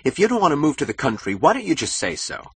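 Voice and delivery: high, loud, rushed challenge; exasperated outburst. A narrator's reading. Husband demanding that his wife speak up, exasperated cry. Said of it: "he cried".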